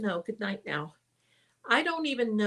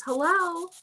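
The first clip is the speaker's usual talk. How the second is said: put-on a light, higher little-girl hello; little girl's voice; 8 semitones higher, 3 dB louder than usual. Retelling the ghost girl heard on the podcast, a mild little-girl hello. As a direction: imitate the tiny ghost girl greeting everyone. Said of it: "it was just this little voice too".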